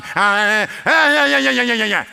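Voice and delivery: whiny run of yeah-yeah-yeahs; loud, mocking yammering; mock griping noise. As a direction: imitate a grumbler going on and on. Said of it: "preacher's yelling all the time".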